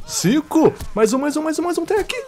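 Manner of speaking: high rising kill count; climbing thrilled count; excited count. Real, not acted. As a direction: hype up each kill. Counting kills in a streak.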